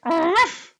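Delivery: high yappy little ruff; little dog ruff. Shown as a demonstration. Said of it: "Well, it's more like".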